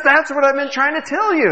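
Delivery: high-pitched exasperated clerk. Acted. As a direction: voice the fed-up clerk's punchline.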